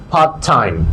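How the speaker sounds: "পার্ট টাইম" with a single t, low and linked; one t in part time. Shown as a demonstration. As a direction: drop the first t, run the words together. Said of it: "একটা টি বলছি". (একটা টি দিয়ে "পার্ট টাইম")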